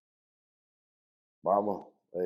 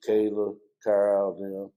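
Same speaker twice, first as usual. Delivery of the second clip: ordinary talk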